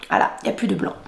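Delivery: breathy whisper to herself. Real, not acted. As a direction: whisper it as you notice.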